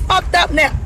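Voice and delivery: real angry outburst. Real, not acted. Ranting to viewers, she bursts out angrily. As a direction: blurt it out hot and fed up.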